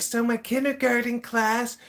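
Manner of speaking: high-pitched mock creepy man. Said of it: "very creepy voice".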